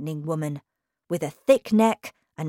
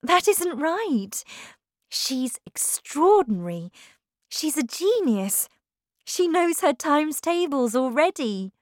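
much higher, earnest, pleading; eager young teacher; 9 semitones higher, 3 dB louder than usual. A narrator's reading. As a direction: insist on the girl's gifts. Miss Honey voiced in story.

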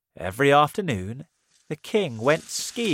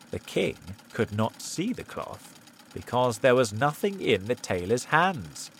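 usual speaking voice

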